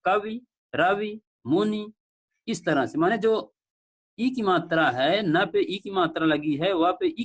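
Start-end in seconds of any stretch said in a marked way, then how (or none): 0.1-1.9 कवि, रवि, मुनि each said slowly with a short final इ; teacher slowing down to model the short इ ending; correct short vowels. Shown as a demonstration. Teacher models correct pronunciation for students, slowly. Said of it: "लेकिन शुद्ध इसका क्या है?".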